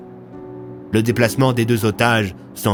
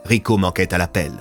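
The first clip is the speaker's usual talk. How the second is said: usual speaking voice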